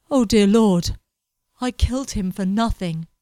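loud anguished cry; a touch of despairing dismay; stricken realisation. Acted. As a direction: horror at what he has done. Edward realises he killed.

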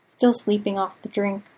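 usual speaking voice

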